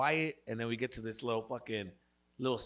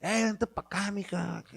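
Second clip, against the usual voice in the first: higher-pitched aggressive paisa come-on; paisa kid; 8 semitones higher, 3 dB louder than usual. Retold or imitated. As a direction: call her over like a forward paisa kid. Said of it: "paisa kids, they're fucking, they're".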